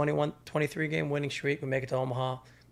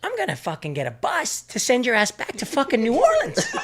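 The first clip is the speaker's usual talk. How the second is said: a laughing, higher coach yell; furious coach; 6 semitones higher, 5 dB louder than usual. Retold or imitated. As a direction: roast the transfer like an angry coach. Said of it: "And he goes".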